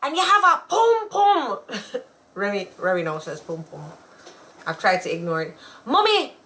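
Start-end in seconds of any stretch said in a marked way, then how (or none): 0.0-1.6 high-pitched exclaiming kid imitation; mock astonishment. Imitating a child who stares at nakedness, mock astonished. 5.8-6.3 put-on high, childlike question to mommy; little kid voice. Imitating small daughter asking mommy about pants, innocent kid voice.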